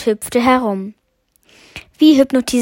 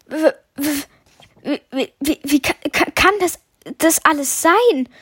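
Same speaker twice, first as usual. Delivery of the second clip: stammering, pitched higher, breaking off syllables; a scared boy tripping over every word; nervous stammer, voice rising; fearful stutter; 4 semitones higher than usual. A narrator's reading. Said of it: "stotterte ich ängstlich".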